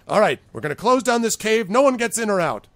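loud, high boss announcement; issuing orders. Acted. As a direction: lay down the law to the whole gang. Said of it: "issuing orders".

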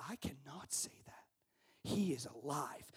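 hushed, slow whisper; whispered defiance. Retold or imitated. Martyr to his persecutor, whispered slow defiance. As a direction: breathe the words out, firm but hushed. Martyr's reply under threat.

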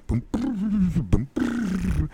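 high mouth drum rolls; high vocal drum fill; drum imitation. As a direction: rattle out a blast beat. Imitating blast beat drums.

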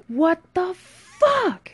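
high, drawn-out, loud outburst; outraged scream. Acted. Friend sees vodka poured out, screaming outrage. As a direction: scream it slowly in disbelief. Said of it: "screamed Dave".

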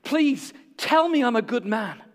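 high, urgent begging; pleading. Acted. Old man to his wife, begging with a lifted voice. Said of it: "He's pleading with her".

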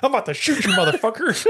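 high, laughing mock threat; Danny McBride voice. Co-host joining the impression of the killer, high and laughing. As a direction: ham up the tough-guy line. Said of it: "Oh, Danny McBride".

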